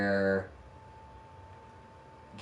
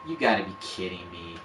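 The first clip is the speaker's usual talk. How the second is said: ordinary delivery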